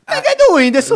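high, loud laughing brag; giddy laughing boast at a friend's luck. Real, not acted. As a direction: crow it with a laugh.

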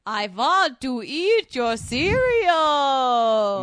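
slow, looming vampire drawl; loud and slow, like a hungry vampire; Count Chocula voice. Acted. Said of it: "Much better".

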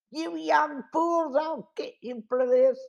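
menacing villain. A narrator's reading. Villain Duke threatens the boys.

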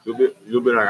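ordinary talk

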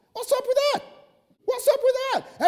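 high, sharp, disbelieving question; incredulous exclamation. Real, not acted. Preacher scolding people who google your past, high incredulous outcry. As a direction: throw it out in disbelief.

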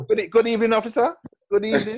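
[name]. Retold or imitated. Friend imitating the performer greeting a police officer, playful mock politeness. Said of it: "the way [name] handled the police officer".

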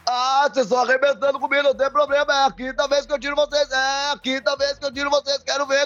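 high, hoarse coach voice; hoarse Lisca impression. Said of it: "eu faço rouco".

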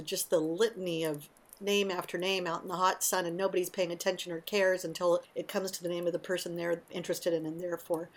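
usual speaking voice

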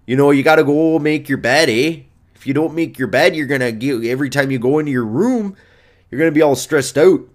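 put-on Canadian accent, rounded vowels. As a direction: lecture like a Canadian coach. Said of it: "I'm doing Canadian".

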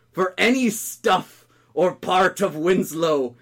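measured, emphatic theatrical curse; furious incantation. Acted. Heartbroken cook erasing his mate from memory, furious incantation. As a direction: pronounce doom phrase by phrase, with pauses. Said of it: "Heartbroken and furious".

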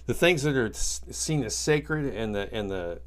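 normal talking voice

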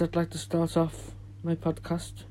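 normal talking voice

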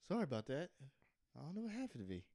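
normal talking voice

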